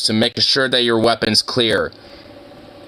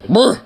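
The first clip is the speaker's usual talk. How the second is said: loud brr; 9 semitones higher, 4 dB louder than usual. Real, not acted. Noise at the dog.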